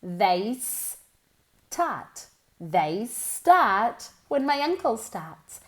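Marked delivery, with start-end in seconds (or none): none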